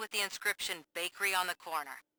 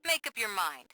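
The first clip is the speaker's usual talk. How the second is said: ordinary delivery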